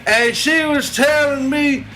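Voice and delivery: folksy Southern barroom salesman voice. Acted. Plays redneck Bubba selling alligator.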